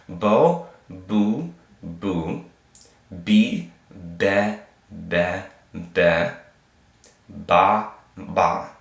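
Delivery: "Bo, boo, be, ba" as isolated held syllables; vowel drill. Shown as a demonstration. Teacher reviewing vowels again, drilled syllable by syllable. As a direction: chant out each syllable with a gap after it. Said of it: "Let's review again".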